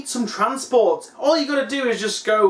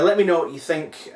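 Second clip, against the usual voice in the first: normal talking voice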